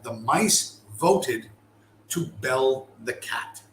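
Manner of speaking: "The mice voted... to bell the cat", drawn out with a pause after "voted"; slow, deliberate, split after "voted"; slow emphatic repeat. Shown as a demonstration. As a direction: repeat it very slowly for weight. Repeats the phrase for weight.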